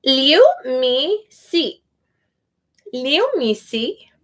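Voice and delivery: "Liu Mi Si" drawn out slowly, each syllable apart; careful slow name reading. Shown as a demonstration. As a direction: spell out "Liu Mi Si" slowly, one syllable at a time. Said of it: "some part of it's gonna be wrong".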